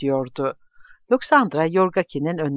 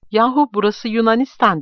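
louder, higher burst of amazement; amazed exclaiming; 7 semitones higher, 5 dB louder than usual. A narrator's reading. Visitor marveling at the neighborhood, amazed exclaiming. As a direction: exclaim it in disbelief. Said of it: "diye söylenip duruyordu".

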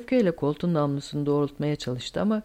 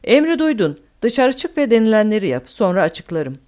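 loud, raised-pitch order; calling out; 4 semitones higher, 8 dB louder than usual. A narrator's reading. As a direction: call the order across firmly. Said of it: "Deacon seslendi". (seslenerek, yüksek sesle emir)

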